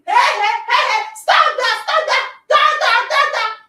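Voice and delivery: teacher yelling. Retold or imitated. A teacher yelling at kids breaking the window, sing-song shout. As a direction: holler at the children to stop, half-chanting. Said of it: "I'm screaming, I'm yelling".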